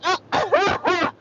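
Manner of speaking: high, loud mocking laugh; mocking cackle. Real, not acted. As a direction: cackle at her expense. Mocking laugh after insult.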